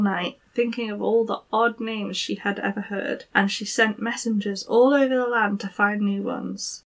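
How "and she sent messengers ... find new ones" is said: ordinary delivery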